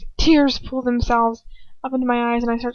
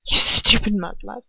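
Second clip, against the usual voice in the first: sneering whisper. A narrator's reading. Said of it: "Draco whispers".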